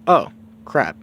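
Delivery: plain reading voice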